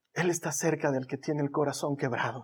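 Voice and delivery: soft unvoiced whisper. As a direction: whisper the verse gently. Whispering the promise.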